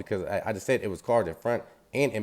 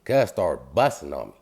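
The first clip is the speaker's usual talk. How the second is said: ordinary talk, nothing marked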